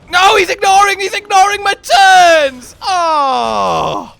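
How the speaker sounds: high, loud, slow outcry; frustrated shout. Real, not acted. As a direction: yell it in outrage. Frustrated outburst at monster.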